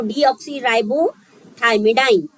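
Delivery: slowly reading deoxyribothymidine syllable by syllable; teacher sounding out a long name; slow spelled-out reading. Shown as a demonstration. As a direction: drag out deoxyribothymidine piece by piece, teacher style. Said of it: "अब मुझे इसी के form में सारे पढ़ना है".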